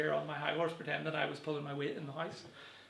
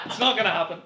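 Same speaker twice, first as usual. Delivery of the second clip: laughing through it, louder; laughing denial; 4 semitones higher, 9 dB louder than usual. Acted. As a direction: blurt it out with a laugh. Mock dramatic repeat of denial.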